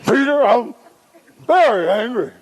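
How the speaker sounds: high, emphatic acted anger; full-body mock anger. Shown as a demonstration. Coached father confronts son with full-body effort, high-pitched mock anger. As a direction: throw the whole body into the anger. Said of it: "Let's have some body involvement".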